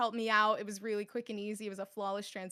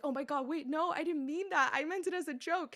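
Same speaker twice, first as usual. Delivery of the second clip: mildly panicked, higher-pitched inner voice; freaking out; 6 semitones higher than usual. Retold or imitated. Caster recalls freaking out over a joke, mock panic. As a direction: retell a frantic scramble to explain. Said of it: "I'm literally freaking out".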